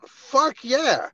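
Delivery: high, loud burst of glee; excited. Real, not acted. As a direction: cheer it out. Enthusiastic about infrared eyeballs.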